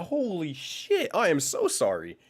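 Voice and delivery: normal talking voice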